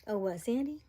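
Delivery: ordinary talk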